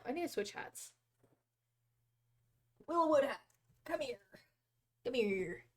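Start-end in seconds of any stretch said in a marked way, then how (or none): none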